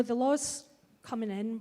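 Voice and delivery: ordinary talk, nothing marked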